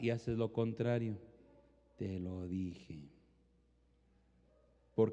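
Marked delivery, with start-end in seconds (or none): 2.0-3.1 1 soft told you so. Pretend. Told-you-so after ignored advice.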